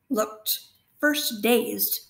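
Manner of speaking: ordinary delivery, nothing marked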